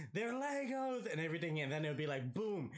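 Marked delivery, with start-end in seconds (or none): 0.1-1.1 high, slow excited call; mock yell. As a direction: call it out in delight. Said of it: "yelling out".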